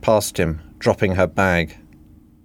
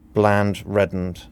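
plain reading voice

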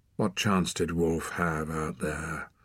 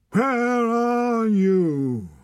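long, high howled call, very slow; desolate howl; 7 dB louder, much slower than usual. Acted. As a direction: howl the words like a lost wolf cub. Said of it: "Torak's desolate howl".

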